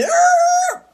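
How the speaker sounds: high-pitched screech like slamming brakes; shrill imitated tire squeal. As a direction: let out a sudden high screech. Soccer mom brakes in shock.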